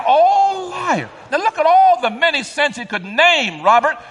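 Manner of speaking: high, loud preacher's delivery, driving it home; full-force preaching. Acted. Preacher reading the list of sinners to the congregation, full-force preaching. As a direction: preach it out loud with rising fervor.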